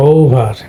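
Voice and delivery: ও ভার with weight on the long ও; first-syllable stress. Shown as a demonstration. Teacher shows students the stress, first-syllable stress. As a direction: stress the ও of ও ভার, let ভার fall. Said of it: "স্ট্রেসটা পড়বে হলো প্রথম সিলেবালে".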